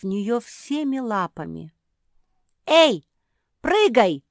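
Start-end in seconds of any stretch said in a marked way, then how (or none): 2.7-4.2 sharp, high, loud order; commanding shout. A narrator's reading. Куки ordering Марсия to jump off the branch, commanding shout. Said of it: "велела Куки".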